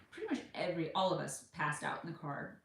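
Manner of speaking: normal talking voice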